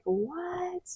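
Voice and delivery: high, hushed gasp of "What?"; a stunned, breathy disbelief; shocked. Retold or imitated. Family hears the baby news, shocked hushed what. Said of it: "I was shocked".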